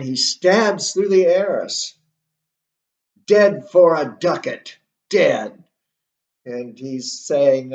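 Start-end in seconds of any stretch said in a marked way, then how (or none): 3.3-5.6 loud, forceful acted exclamation; crying out. Hamlet stabbing through the arras, crying out. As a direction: declare it loudly as a triumphant strike. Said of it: "he cries out himself".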